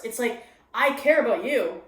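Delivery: ordinary talk, nothing marked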